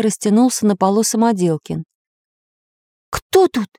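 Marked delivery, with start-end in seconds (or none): 3.1-3.7 high, startled question; frightened voice. A narrator's reading. Hidden stranger challenging newcomers in the dark, frightened high voice. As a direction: ask in a raised, scared voice. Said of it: "раздался испуганный голос".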